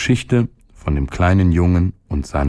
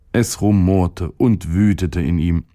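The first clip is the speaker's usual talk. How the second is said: usual speaking voice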